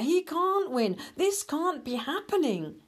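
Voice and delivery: high desperate disbelief. Acted. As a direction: plead against what's happening. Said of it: "cried Hare".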